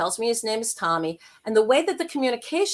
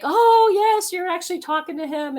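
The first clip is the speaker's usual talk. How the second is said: lightly voiced mother, higher with an excited "yes"; a hint of the mother's relief, pitched up and then easing off; relieved mother; 9 semitones higher than usual. Grieving mother finally convinced by the medium, high relieved exclaim. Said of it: "she's like".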